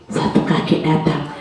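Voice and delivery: ordinary delivery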